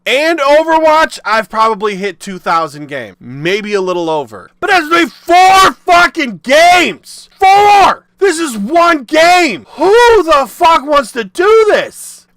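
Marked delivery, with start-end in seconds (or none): 4.6-12.3 loud, high shouting in real outrage; furious shout. Real, not acted. Ranting to viewers about grinding, furious shout. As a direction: yell it, fed up with the grind.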